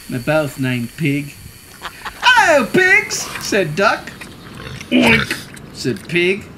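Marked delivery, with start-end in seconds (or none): 2.2-3.2 high, loud, drawn-out greeting; Duck's cheery call. A narrator's reading. Duck greeting the pigs, high loud drawn-out call. 4.9-5.3 short, high, loud oink; pig oink. A narrator's reading. Pig answering Duck, short loud oink. Pig's sound in the story.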